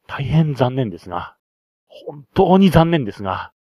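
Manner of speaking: hammed-up disappointment; mock regret. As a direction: lay on the regret, stretching the words. Mock regret over players' dialect difficulty.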